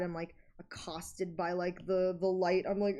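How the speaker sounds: ordinary talk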